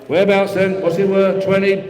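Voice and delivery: rhythmic, repetitive bid chant; auctioneer bid calling. Acted. Auctioneer taking bids from the room, steady patter. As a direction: keep the numbers rolling to the room.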